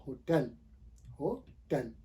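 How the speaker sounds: "होटल" as ho-TEL, repeated for learners; correct pronunciation. Shown as a demonstration. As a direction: clear open e ending in होटल. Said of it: "इसको हम pronounce करेंगे".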